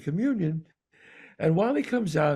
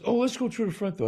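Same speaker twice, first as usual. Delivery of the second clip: quick, lightly higher breezy suggestion; quoting Johnny; 6 semitones higher, faster than usual. Retold or imitated. Retelling her casual idea at church, quick and light. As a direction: toss off the idea casually, a bit faster. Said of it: "and Johnny goes".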